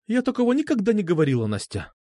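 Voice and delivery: cool, understated distance; detached denial. A narrator's reading. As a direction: deny it at a remove, dropping off at the end. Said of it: "сказала Альфа отстранённо".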